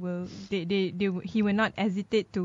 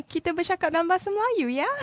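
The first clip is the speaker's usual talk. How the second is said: speaking Malay; 8 semitones higher, 3 dB louder, much faster than usual. Acted. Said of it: "I don't think you heard me speaking in Malay".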